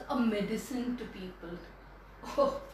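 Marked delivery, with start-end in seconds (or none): none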